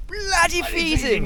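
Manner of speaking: put-on high, slow, plummy posh accent; exaggerated posh English drawl. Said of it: "I'm speaking posh again".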